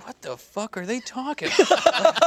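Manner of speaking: confused. Retold or imitated. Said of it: "I was like".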